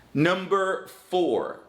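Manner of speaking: slow, high heading; announcing a heading. Shown as a demonstration. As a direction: call out the next point slowly.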